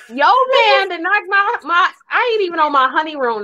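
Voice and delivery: high-pitched, lightly hammed-up protest; mock whining complaint. Podcaster voicing the groom stuck at hospital, mock complaint.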